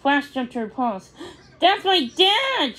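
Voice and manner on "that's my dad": high, slower surprised cry; a startled gasp of recognition; much higher, amazed. A narrator's reading. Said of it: "she gasped".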